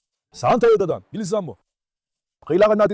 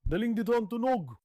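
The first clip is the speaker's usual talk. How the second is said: much softer, a little higher, near a whisper; hushed note on the sound's source; 4 semitones higher, 13 dB quieter than usual. Real, not acted. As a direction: barely voice it while creeping close. Sneaking toward the noise.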